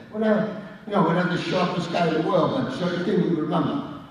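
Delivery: ordinary delivery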